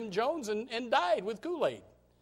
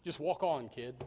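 snide remark; 7 semitones lower, 6 dB quieter than usual. Retold or imitated. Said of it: "some snide little remark".

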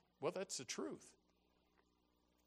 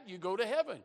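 ordinary talk, nothing marked

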